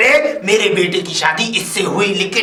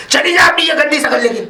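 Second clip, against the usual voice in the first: high, raised-voice order; angry dismissal; 6 semitones higher, 4 dB louder than usual. Acted. Father-in-law sending daughter-in-law away, angry. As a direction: throw them out with contempt.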